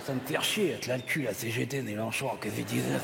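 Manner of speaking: low, soft monotone grumble; grumbling aside. Acted. Puppet complaining about the partner to himself, grumbling low. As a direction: grouse to yourself in a low litany.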